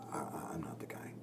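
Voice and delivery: breathy whispered reluctance; whispered Moses. Retold or imitated. Retelling Moses refusing God, in a whisper. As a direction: shrink away and whisper it. Moses voiced in retold story.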